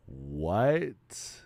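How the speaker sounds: rising shocked question; disbelieving what. Real, not acted. Disbelief at found clip.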